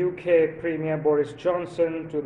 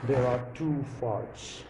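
Morpheus; 4 semitones lower than usual. Pretend. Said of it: "Morpheus would go something like this".

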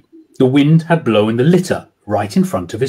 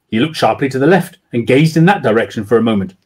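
ordinary delivery

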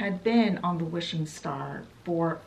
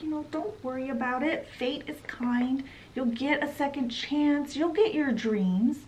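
higher, softer, quick and soothing; reassuring; 6 semitones higher, 4 dB quieter than usual. Retold or imitated. Geppetto consoling Ilaria after the audition, soft and higher. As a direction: coax her out of sadness.

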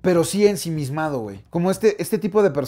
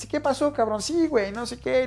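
much higher, chummy bro greeting; relaxed high slangy hello; casual buddy greeting; 9 semitones higher, 3 dB quieter than usual. Retold or imitated. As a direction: toss it off like greeting an old pal. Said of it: "Siempre les habla como".

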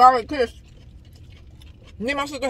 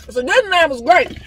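loud; 4 semitones higher, 6 dB louder than usual. Real, not acted. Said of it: "being yelled at".

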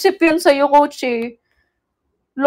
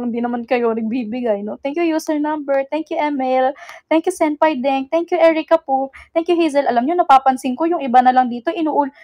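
usual speaking voice

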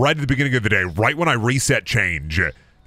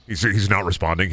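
normal talking voice

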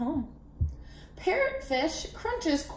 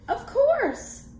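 character voice; 12 semitones higher than usual. Acted. Crab answering.

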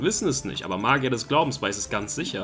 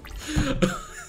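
high disbelieving laugh; 13 semitones higher, 5 dB quieter than usual. Real, not acted.

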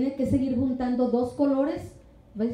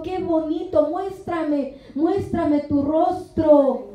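higher, slow, tender coaxing; 5 semitones higher, slower than usual. Pretend. The Lord tenderly coaxing his shy beloved, higher and slow. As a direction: lovingly coax a shy beloved to show her face. The Lord's tender words voiced.

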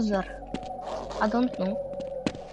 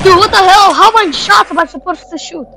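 loud, high-pitched outburst of disbelief; incredulous, raised-voice complaint; exasperated shout; 7 semitones higher, 11 dB louder than usual. Real, not acted. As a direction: yell it in frustration at the game. Exasperated at game while shooting.

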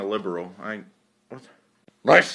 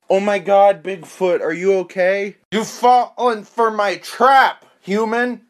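frantic shouting; 7 semitones higher, 6 dB louder than usual. Acted. Bigfoot skit, acted voices.

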